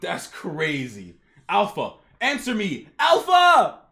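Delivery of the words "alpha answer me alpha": building to a high shout; urgent calling. Acted. Commander calls to Alpha who won't answer, urgent shout. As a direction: call out, ending in a loud yell.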